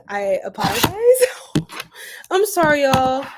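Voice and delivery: laughing, crying-out apology; giggly apology. Real, not acted. Repeated apology, laughing cry.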